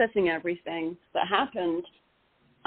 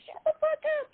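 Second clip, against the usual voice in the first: much higher mock New York snap; New Yorker snapping; faster than usual. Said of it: "New York people being like".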